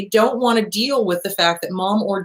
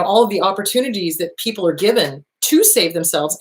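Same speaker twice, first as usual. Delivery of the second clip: normal talking voice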